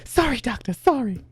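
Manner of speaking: squeaky-high apology; put-on high little voice; high-pitched character voice. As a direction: imitate her with a very high voice. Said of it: "just in a really high-pitched voice".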